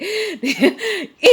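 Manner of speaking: squealing high laugh; high giggle. Retold or imitated. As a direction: burst out in a high, giddy giggle. Friends' eager story demand.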